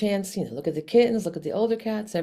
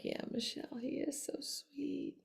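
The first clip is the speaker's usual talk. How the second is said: whispered, melting agreement; barely voiced, surrendering softly; hushed giving-in; 7 semitones higher, 15 dB quieter than usual. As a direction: drop to a very soft whisper.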